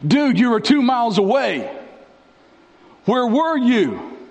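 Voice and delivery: high-pitched exasperated complaint; acted exasperation. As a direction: protest like a let-down friend. Acted reproach at Jesus for delay.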